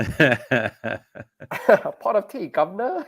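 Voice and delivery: slow, hammed-up Cockney British accent; mock British accent, badly faked. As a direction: drawl it out as a clumsy British accent. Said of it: "you did a bad accent".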